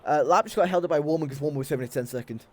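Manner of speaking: ordinary talk, nothing marked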